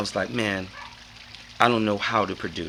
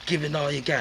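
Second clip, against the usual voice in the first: higher sampled hook voice; 5 semitones higher than usual. Shown as a demonstration. Showing the sample he made, higher. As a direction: deliver it like a sampled loop. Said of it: "So I sampled".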